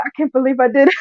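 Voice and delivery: loud, high, laughing through the words; laughing and loud, still amazed at herself; laughing disbelief. Real, not acted. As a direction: crack up at your own nerve. Laughing at her own boldness.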